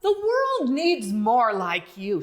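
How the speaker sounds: kind driver. Acted. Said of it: "said the driver".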